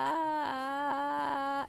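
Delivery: creaky fry with a pitch; vocal fry attempt. Shown as a demonstration. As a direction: try the fry-pitch blend. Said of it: "is that it?".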